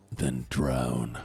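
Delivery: low, flat-voiced deadpan verdict; cold deep dismissal. Obama answering the drowning plea, low and cold. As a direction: coldly refuse to help.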